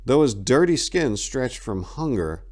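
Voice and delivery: plain reading voice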